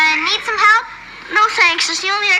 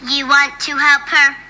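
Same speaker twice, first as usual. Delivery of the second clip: lower, monotone robot echo; robot girl; 4 semitones lower than usual. Acted. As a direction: parrot the words like a machine. Robot girl echoes the instruction literally.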